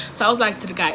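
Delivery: ordinary delivery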